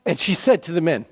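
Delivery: ordinary delivery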